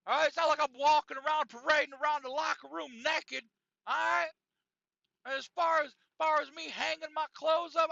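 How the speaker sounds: high, loud indignant self-defence; Sauna Santa lighting up. Retold or imitated. Sauna Santa arguing back at Bob, imitated indignant rant. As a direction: act out an aggrieved man arguing his case. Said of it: "Sauna Santa lights him back up".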